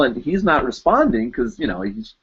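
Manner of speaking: ordinary talk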